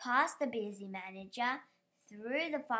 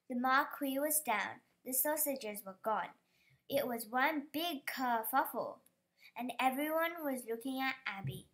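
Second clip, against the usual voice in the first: ordinary delivery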